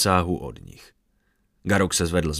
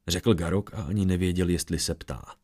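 plain reading voice

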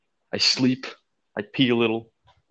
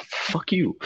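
mock defiant retort; 7 semitones higher than usual. Retold snapping at annoyed family.

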